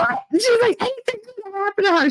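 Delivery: very high-pitched mock alarm; squeaky, hammed-up worrying; mock panicked mother. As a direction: imitate a nervous mother fretting. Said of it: "you nervous Nelly".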